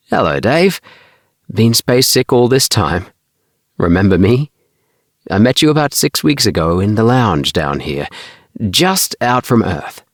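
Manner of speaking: lightly voiced cheerful boy; cheery friend. A narrator's reading. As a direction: greet an old friend eagerly. Tommy greets Bart cheerily.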